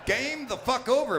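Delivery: mock fed-up shout. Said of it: "I'm like".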